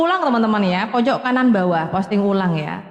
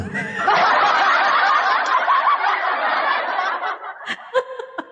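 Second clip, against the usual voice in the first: a long laugh rising very high. Real, not acted. Woman laughing hard with viewers, long high laugh. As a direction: let a high laugh run on.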